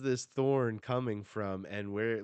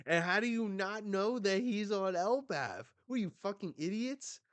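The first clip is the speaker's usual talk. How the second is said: high-pitched exasperated disbelief; incredulous scolding; 9 semitones higher than usual. Real, not acted. Fan berating others for not knowing, incredulous. Mock scolding of clueless fans.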